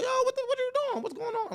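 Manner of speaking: sudden high, fast exclamation; passionate outburst. Shown as a demonstration. Father reacting to his kid with passion. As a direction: burst out in heated disbelief. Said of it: "I get passion".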